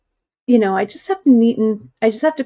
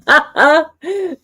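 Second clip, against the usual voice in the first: laugh; 7 semitones higher, 4 dB louder than usual. Real, not acted. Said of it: "That's funny".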